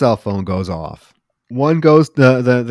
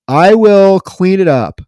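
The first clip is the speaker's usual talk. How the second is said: slow, loud, higher-pitched mock compliance; retold awkward reply; 8 semitones higher, 9 dB louder, slower than usual. Killer answering his angry boss, slow deliberate mock compliance. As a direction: say each word deliberately, as the scolded henchman.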